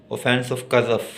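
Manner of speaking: normal talking voice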